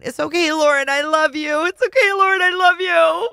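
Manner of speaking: host mocking the author's mirror talk; mocking imitation. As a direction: parody her self-comfort, hammed up. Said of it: "having her looking in the mirror going".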